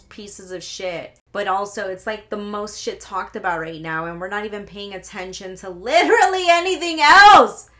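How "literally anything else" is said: loud, higher-pitched exasperation; exasperated outburst. Real, not acted. Ranting to viewers about what gets ignored, exasperated outburst. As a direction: let the rant burst out loudly. Worked-up rant reaches its peak.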